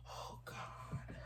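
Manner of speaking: breathless whisper of distress; whispered Oh, God. Real, not acted. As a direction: let it slip out as a whisper. Overwhelmed while reading her list.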